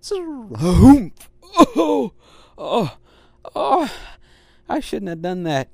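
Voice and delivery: loud, high yelps of pain. Acted. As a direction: cry out after the hard landing. Dog hurt after jumping.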